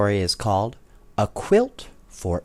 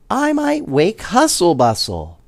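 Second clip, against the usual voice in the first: 1 squeaky Dodo; 9 semitones higher, 5 dB louder than usual. A narrator's reading. Dodo keeps speaking.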